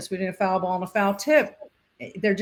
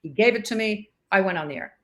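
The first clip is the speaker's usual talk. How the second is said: usual speaking voice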